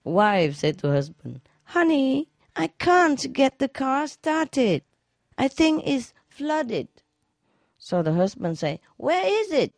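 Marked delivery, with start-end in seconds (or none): 1.7-6.9 wife's voice. Acted. Joke, wife voiced by teller. 9.0-9.7 puzzled husband. Acted. Joke, husband asks about car.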